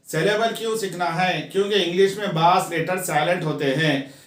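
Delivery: ordinary delivery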